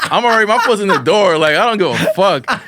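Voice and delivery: laughing defiance. Retold or imitated. Mocking his old teacher's report request, loud laughing. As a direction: crack up while mouthing off.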